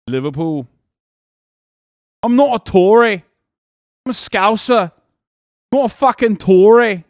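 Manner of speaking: loud, high-pitched attempt at Scouse; exaggerated Liverpool accent, punched out. Streamer attempting an accent chat asked for, mock Scouse. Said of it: "Liverpool accent".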